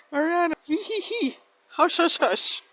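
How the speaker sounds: high, teasing hush hush; imitating a coy shush; mock giggly hushing.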